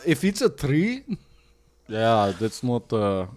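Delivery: put-on slow Russian accent; drawn-out mock Russian voice. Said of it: "the Russian accent".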